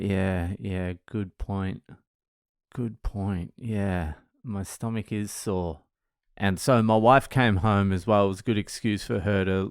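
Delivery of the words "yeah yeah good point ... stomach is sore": soft, slow mock sick-kid voice; feigned sore stomach. Son agreeing with dad about his sore stomach, feigning sickly. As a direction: agree weakly, quietly, playing up the bellyache. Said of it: "you can pretend you've got a sore stomach".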